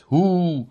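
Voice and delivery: drawn-out echoing call; echoed hoot. A narrator's reading. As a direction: hoot it as a returning echo. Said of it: "Echo wzbiło się".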